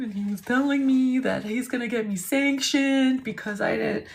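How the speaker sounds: high whiny mock-tearful client; on the verge of tears. Panicked client phoning his lawyer about sanctions, mock-tearful. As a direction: whimper it, imitating a client close to tears. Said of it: "Sounds like he's on the verge of tears".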